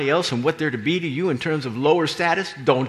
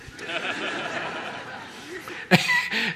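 breathy laugh; 8 semitones higher than usual. Real, not acted.

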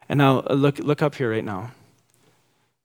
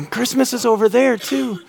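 put-on toddler voice again; 10 semitones higher than usual. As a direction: squeal it like the little one. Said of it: "my two-year-old in the backseat go".

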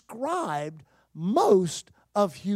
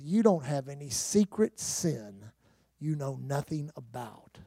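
low, slow, soft retold assurance; gentle reassurance; 8 semitones lower, 7 dB quieter than usual. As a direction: quietly release her from the worry. Retold reassurance to the distressed woman.

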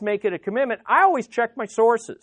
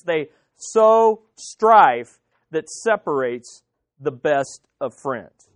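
very slow, low, spaced-out words; slow emphatic repeat; 5 semitones lower, much slower than usual. Shown as a demonstration. Preacher driving home gossip's damage, slow and deliberate. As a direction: weigh "sow strife" and "separates", pausing between.

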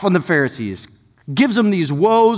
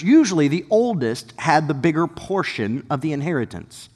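normal talking voice

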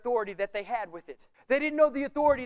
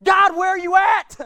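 loud, much higher cry to God; desperate shout; 8 semitones higher, 14 dB louder than usual. Retold or imitated. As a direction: yell it in frustration. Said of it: "When things get tight".

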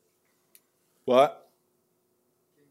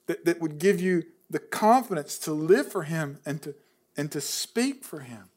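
much softer, choked-up pleading; real emotion; 3 semitones lower, 5 dB quieter than usual. Real, not acted. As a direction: hold back tears while urging them. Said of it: "I'm sorry I'm emotional".

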